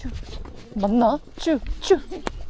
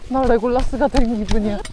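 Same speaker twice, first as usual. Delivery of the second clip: usual speaking voice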